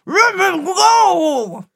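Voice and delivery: slow loud high roar; roaring leopard. Acted. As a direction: bellow it, struggling to get free.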